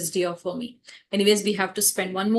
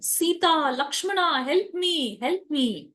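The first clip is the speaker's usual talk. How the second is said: higher, pleading calls for help, only a mild mock scream; mock cry for help; 7 semitones higher, slower than usual. Demon imitating Rama, calling to Sita and Lakshmana, high pleading cry. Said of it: "he starts screaming".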